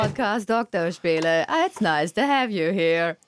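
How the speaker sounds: slow mechanical robot voice. Acted. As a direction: speak like a stiff robot. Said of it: "die Roboterstimme auspacken".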